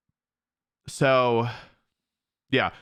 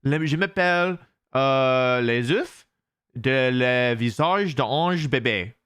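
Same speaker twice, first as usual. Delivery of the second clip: slow, playful attempt at French; drawn-out pretend French with hesitations; mock French voice; slower than usual. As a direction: feel your way through halting French. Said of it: "That's what my real French sounds like".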